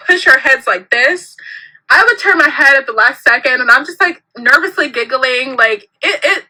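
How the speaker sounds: ordinary delivery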